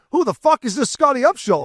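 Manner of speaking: high-pitched imitation of a Boston accent; mimicked Boston woman, voice jumping high. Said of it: "she's saying it in this, like, Boston accent".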